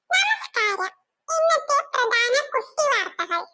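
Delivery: news bulletin through a radio; radio news read. Acted. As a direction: announce the headlines like a small radio.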